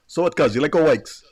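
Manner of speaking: loud Hawaiian Pidgin question; Pidgin cousin. Retold or imitated. Cousin asking in Pidgin about going to Waikiki, loud and casual. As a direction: ask it like a local cuz, punchy. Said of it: "And you go".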